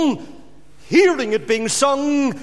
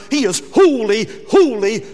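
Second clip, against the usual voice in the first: louder, insistent holy, holy, holy; louder proclamation with full conviction; proclaimed loudly; 6 dB louder than usual. Shown as a demonstration. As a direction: declare it to the congregation. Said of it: "But you do read here".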